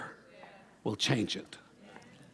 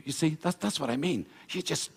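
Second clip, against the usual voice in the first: ordinary talk, nothing marked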